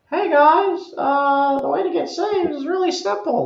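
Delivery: very high, meek put-on voice; squeaky-high, hammed-up patheticness; sad, pitiful voice. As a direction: imitate a pitiful little preacher. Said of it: "when sad, pitiful, uh, Stephen comes on".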